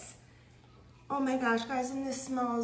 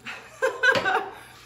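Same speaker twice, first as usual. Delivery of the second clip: embarrassed laugh; 12 semitones higher, 6 dB louder than usual. Real, not acted. Laughs at own mouth full.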